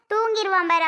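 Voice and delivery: ordinary talk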